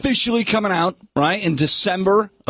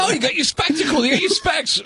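put-on high, bouncy Cockney accent; 10 semitones higher than usual. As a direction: say it like a London Cockney. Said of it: "Was that your Cockney accent?".

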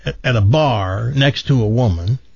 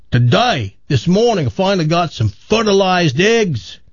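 higher, hammed Aussie-British farmer; put-on Australian-British accent; 4 semitones higher than usual. Chicken farmer tells woman his news, mock Australian-British accent. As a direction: tell it in a broad fake Australian-British accent. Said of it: "what's with that accent".

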